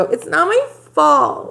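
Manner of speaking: slow, high whiny "not my fault"; whiny mimic. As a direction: drag it out like a sulky victim. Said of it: "for her to go".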